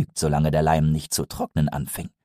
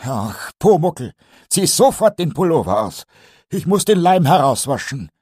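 put-on higher, louder old carpenter, sighing then firm; Eder pleading; 8 semitones higher, 5 dB louder than usual. Meister Eder begs Pumuckl to take it off, higher and louder. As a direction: coax the goblin out of the sweater.

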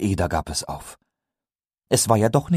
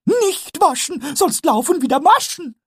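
very high, louder goblin shriek of a rhyme; Pumuckl shouting; 4 dB louder than usual. Acted. Pumuckl shouts his rhyme at Eder from behind the saw, very high. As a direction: yell it gleefully like a kobold. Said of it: "schrie er dazu".

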